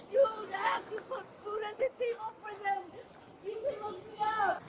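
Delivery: fast, choked-up sobbing words; crying. Real, not acted. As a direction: voice breaking into tears. Breaking down during arrest.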